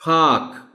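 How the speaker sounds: पार्क as a long "paak"; silent r. Shown as a demonstration. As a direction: model पार्क loudly without r. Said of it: "आर is silent". (پارک r کے بغیر، "پاک")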